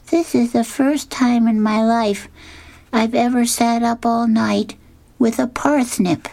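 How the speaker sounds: Linus's high voice, sitting out in the cold. A narrator's reading. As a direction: pitch up to play little Linus. Linus voiced in comic reading.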